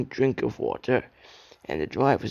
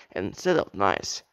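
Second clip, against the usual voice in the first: usual speaking voice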